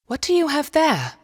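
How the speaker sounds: high-pitched, inquisitive question; curious little girl. A narrator's reading. Lillemor asks her brother about his find, curious. Curious sister voiced in story.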